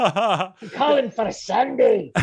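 slow, high put-on Scottish accent; drawn-out joking Scottish brogue; a hammed-up Scottish caller. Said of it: "I'll do it in a Scottish accent".

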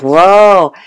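a burst of delight from the vet; excited whoa. Retold or imitated. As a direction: vet thrilled at the healthy teeth. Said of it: "all excited".

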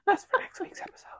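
high, very soft aside; hushed aside. Real, not acted. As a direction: hush it as a teasing secret.

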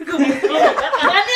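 laughing through the words; cracking up while repeating it. Real, not acted.

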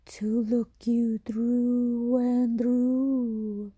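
creature's whisper. Acted. Creature answers the boy.

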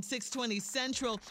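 ordinary talk

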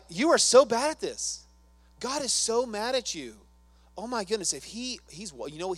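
loud, high mock berating; hammed-up scolding Satan voice; accusing rant. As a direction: rant at him like a scornful accuser. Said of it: "He does this to me every day".

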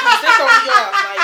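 high, loud, slow laughing. Real, not acted. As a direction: crack up while saying it. Laughing at the neighbors.

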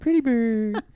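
high squawky parrot voice. Pretend. Repeats parrot joke.

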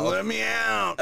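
very high cartoon kid squeal; Big Mouth kid. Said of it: "that's who you sounded like".

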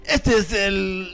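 radio announcer voice. Retold or imitated. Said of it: "y lo daban en la radio".

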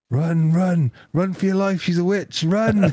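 high, urgent shout to flee; mock alarm. Doorbell prank on the delivery person, mock-panicked shout. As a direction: yell a jokey, high-pitched warning.

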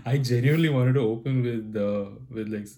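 ordinary talk

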